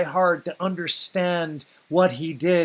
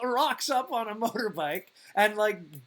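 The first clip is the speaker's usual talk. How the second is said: ordinary talk, nothing marked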